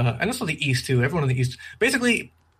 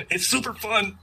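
laughing; 8 semitones higher than usual. Real, not acted. Laughing while saying it.

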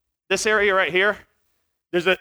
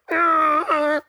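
high mock donkey noise; donkey bray; 9 semitones higher than usual. The donkey turning back on him, mock bray. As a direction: imitate the donkey's startled bray. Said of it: "She turns back around and goes".

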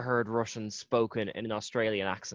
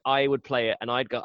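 ordinary talk, nothing marked

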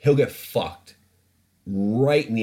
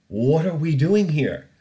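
louder, slower, stress on "doing"; exasperated; 8 semitones higher, 5 dB louder, slower than usual. Real, not acted. Host despairing at Chernobyl vodka imports, exasperated. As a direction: throw "What are we doing here?" out in disbelief. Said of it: "repeated laughing".

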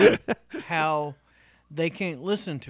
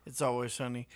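ordinary talk, nothing marked

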